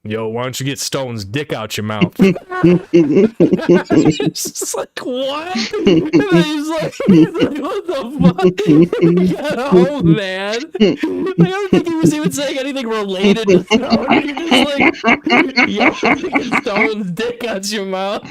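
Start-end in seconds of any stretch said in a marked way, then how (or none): 2.2-15.7 loud, high laughing, words squeezed out; cracking up. Real, not acted. Retelling the shocking insult to friends, laughing loudly. 15.7-18.2 louder, higher, laughing through the line; laughing quote. Retold or imitated. Quoting the friend's insult again to him, laughing.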